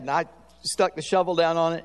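ordinary delivery

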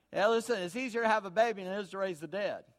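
ordinary talk, nothing marked

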